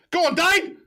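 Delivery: high, loud roar of encouragement; full-volume go on Dane; urging shout. Real, not acted. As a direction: scream him on toward goal. Urging a striker through on goal.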